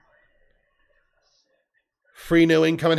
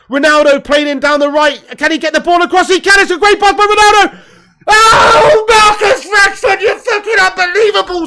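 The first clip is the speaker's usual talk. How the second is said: from eager to a full high scream, much higher and louder; rising excitement; 9 semitones higher, 8 dB louder than usual. Real, not acted. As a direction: build with the attack until you explode. Chance unfolding, fan watching live.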